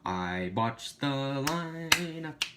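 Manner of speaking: drawn-out very slow repeat of the flub; slow-motion repeat. Pretend. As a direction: stretch every word out very slowly.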